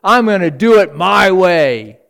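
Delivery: loud defiant voice shaking a fist at God; defiant rebel. Retold or imitated. Rebel defying God, loud and defiant. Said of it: "the guy who's shaking his fist in God's face".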